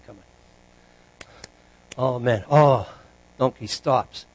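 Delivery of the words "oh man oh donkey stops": ordinary talk, nothing marked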